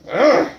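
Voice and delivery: loud effortful grunt. Acted. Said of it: "I go".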